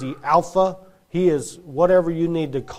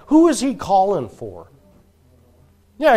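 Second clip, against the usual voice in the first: usual speaking voice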